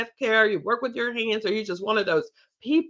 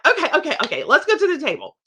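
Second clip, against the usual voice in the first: brisk rallying call; 5 semitones higher, 5 dB louder, much faster than usual. Retold or imitated. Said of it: "It's like".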